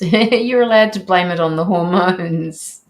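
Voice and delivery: laughing loudly through the words. Real, not acted. Laughing over hormones remark.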